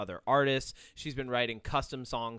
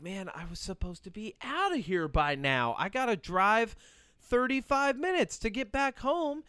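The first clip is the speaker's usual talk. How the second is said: mock exasperation; 8 semitones higher than usual. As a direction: gripe to yourself, stretching it out higher. Griping at late class.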